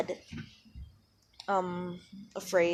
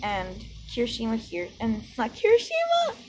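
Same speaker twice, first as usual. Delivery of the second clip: ordinary delivery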